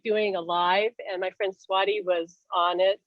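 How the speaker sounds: ordinary talk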